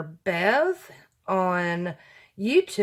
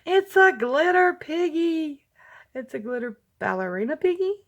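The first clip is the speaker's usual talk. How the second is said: delighted; 9 semitones higher, 4 dB louder than usual. Real, not acted. Delight at piggy embellishment.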